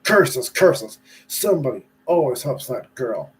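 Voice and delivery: loud angry witch cry; witch cursing. Acted. Wicked Witch raging at her crystal ball, loud angry curse. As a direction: spit out the curses.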